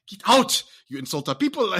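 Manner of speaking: put-on high, loud African-accented outrage; hammed-up indignation in a stock African accent; mock African accent. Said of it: "They're gonna be like".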